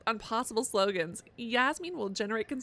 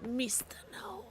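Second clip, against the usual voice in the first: softer unvoiced taunting whisper; 3 semitones higher, 7 dB quieter, slower than usual. Player whispering menacingly to a gnome. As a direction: hiss the name like a threat.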